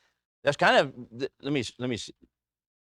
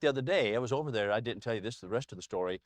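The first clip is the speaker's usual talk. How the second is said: usual speaking voice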